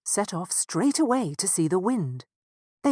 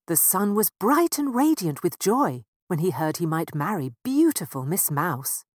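ordinary delivery, nothing marked

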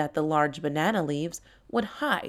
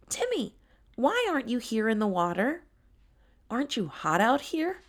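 a touch higher, puzzled asking; lightly voiced tiger Tyler; 6 semitones higher than usual. Tyler asking Timmy why he stays out, lightly puzzled. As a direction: coax him gently into the water.

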